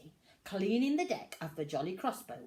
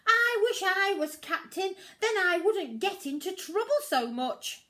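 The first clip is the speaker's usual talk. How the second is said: Pete wishing; 12 semitones higher, 8 dB louder than usual. A narrator's reading.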